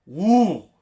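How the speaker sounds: high, loud "woo"; excited whoop. Real, not acted. Fan raving about the musical numbers, excited whoop. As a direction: whoop it out in delight.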